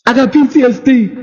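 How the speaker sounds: mock complainer. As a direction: parody people claiming a diagnosis. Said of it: "Everybody's claiming it".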